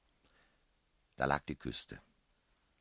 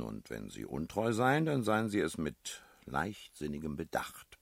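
ordinary delivery, nothing marked

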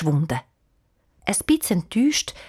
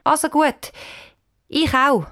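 half convinced; 7 semitones higher than usual. A narrator's reading. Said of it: "sagt mäßig überzeugt".

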